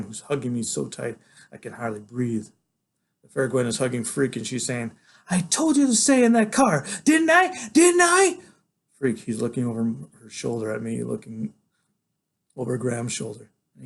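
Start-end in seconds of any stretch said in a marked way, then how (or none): 5.3-8.4 very high, loud scolding; a woman scolding a runaway kid. Acted. Gwen scolding Freak, very high and loud.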